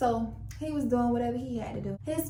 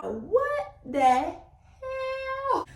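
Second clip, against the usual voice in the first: very high, long-drawn squeal of disbelief; hammed-up shock; 5 dB louder, much slower than usual. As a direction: stretch it into a loud mock shriek. Acted shock at police raid chaos.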